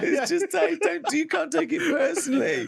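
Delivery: high, squeaky words through giggles; laughing while talking. Real, not acted. Laughing at his own point about taste.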